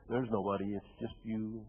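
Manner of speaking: low and very soft, phrase by phrase; hushed, lonely. Real, not acted. Speaker sharing that alone feeling with listeners, hushed and low. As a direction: let it sink almost to nothing, low and sparse.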